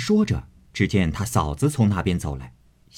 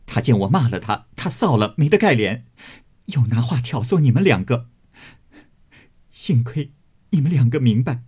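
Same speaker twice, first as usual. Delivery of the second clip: still angry; 5 semitones higher than usual. Acted. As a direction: still fuming as you explain. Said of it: "鸳鸯气得还骂". (余怒未消地数落)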